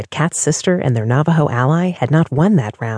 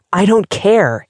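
angry; 6 semitones higher, 4 dB louder, slower than usual. A narrator's reading. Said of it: "angrily shaking off Diana's hand".

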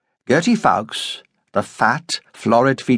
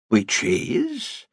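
doubtful; 5 dB quieter than usual. A narrator's reading. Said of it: "frowned doubtfully".

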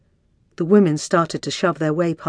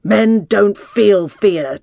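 slow, loud, stern bellow; father's bellow; 4 semitones higher, 7 dB louder, much slower than usual. A narrator's reading. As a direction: bellow it slowly at a frightened son. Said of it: "Father's bellow".

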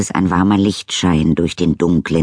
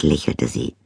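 ordinary delivery, nothing marked

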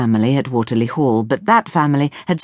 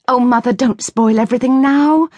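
moaning; 8 semitones higher, 3 dB louder than usual. Acted. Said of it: "Ruth moaned".

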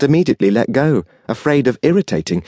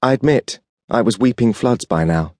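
usual speaking voice

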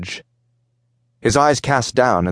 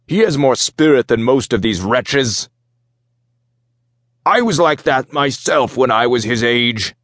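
raised, forceful Minotaur voice; approving Minotaur warrior; 4 semitones higher than usual. Acted. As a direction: speak up as a hardened warrior.

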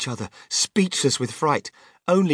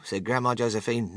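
usual speaking voice

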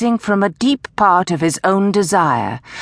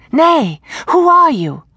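high, louder challenge; alarmed demand; 10 semitones higher, 5 dB louder than usual. A narrator's reading. Woman confronts a teasing voice in gloom, high alarmed demand. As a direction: press the stranger, voice pitched up and raised.

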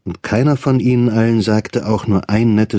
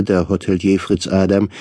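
plain reading voice

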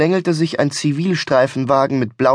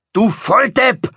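high, furious outburst; yelled insult; 9 semitones higher, 3 dB louder, slower than usual. Acted. Driver yanks his friend back inside, yelled insult. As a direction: spit out the insult with pitch shooting up. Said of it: "schrie er".

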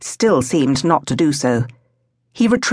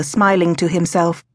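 usual speaking voice

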